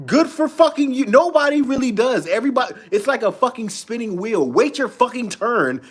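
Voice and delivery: high-pitched, fed-up rant; exasperated rant. Real, not acted. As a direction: let the pitch ride up in exasperation. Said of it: "having a little bit of a rant".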